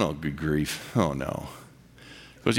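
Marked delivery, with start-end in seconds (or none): 0.0-1.5 lower, softer, weary dread; a mock sinking groan at the invitation; imitating his own reluctant dismay. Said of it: "I'm going".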